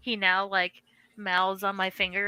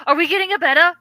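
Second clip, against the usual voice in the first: excited question; 8 semitones higher, 9 dB louder, much faster than usual. Retold or imitated. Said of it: "I was like".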